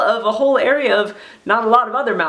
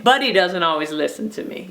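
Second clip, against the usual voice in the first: ordinary delivery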